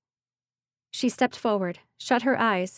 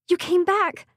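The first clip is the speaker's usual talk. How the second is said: high, loud joyful exclamation; excited; 10 semitones higher, 5 dB louder than usual. Acted. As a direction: burst out with delight. Pixie returns unexpectedly.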